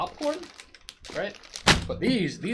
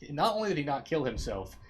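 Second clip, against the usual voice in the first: ordinary talk, nothing marked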